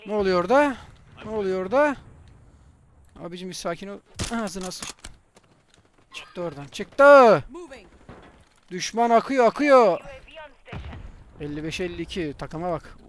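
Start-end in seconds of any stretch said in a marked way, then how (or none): 0.1-2.0 higher, louder surprise; alarmed question. Real, not acted. Confused at chaos. 6.7-7.4 sudden loud high yell; excited shout. Real, not acted. 8.7-10.0 loud, high warning yell; alarmed shout. Real, not acted. Enemies pouring in, alarm.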